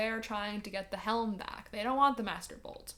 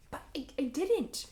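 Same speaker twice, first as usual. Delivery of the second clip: stammering Percy; 5 semitones higher than usual. A narrator's reading. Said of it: "stammering Percy".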